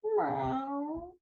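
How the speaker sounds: soft, rising cat meow. Acted. Cat meows softly to her tired friends. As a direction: meow quietly.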